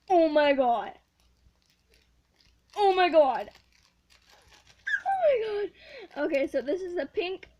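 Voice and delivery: loud gushing oh my god; overjoyed squeal. Real, not acted. Girl opening panda bun squishy, loud delighted gushing.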